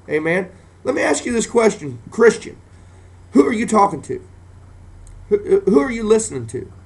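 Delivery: rising preacher's cry; fiery preaching. Acted. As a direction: climb into a fiery preaching peak. Preaching climax.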